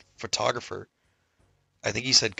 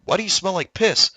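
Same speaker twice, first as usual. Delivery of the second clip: high, louder mock bewilderment; retold baffled question; 9 semitones higher, 6 dB louder than usual. Soldiers questioning the beaten guy, mock baffled. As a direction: act out an incredulous question.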